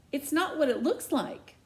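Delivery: panicked quick denial; blurted denial. Acted. Teen blurting a denial to her shocked mom. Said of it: "I blurt".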